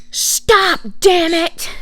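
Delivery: high, loud mock scolding; scolding aunt. Imitating aunt swearing at a toddler, high loud scolding. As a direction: snap it sharply as the angry aunt. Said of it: "And she goes".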